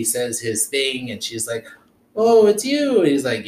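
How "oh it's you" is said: high, loud acted realization; imitating a surprised "oh"; surprised recognition. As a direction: voice her delighted shock of recognition, pitched up. Acted recognition in retold film scene.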